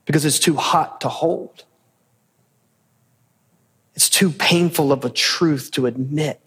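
low, measured sermon voice; solemn preacher's challenge; earnest preaching. Acted. Preacher confronting the congregation.

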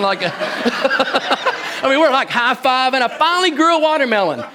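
high, laughing through the words. Real, not acted. Pastor recalling his watermelon triumph, laughing high voice. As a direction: laugh while telling the triumph.

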